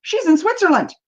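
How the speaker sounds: high-pitched retold disbelief; mock astonishment. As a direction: act out a friend caught off guard. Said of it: "I look on, I'm like".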